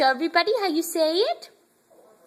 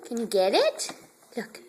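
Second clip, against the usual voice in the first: normal talking voice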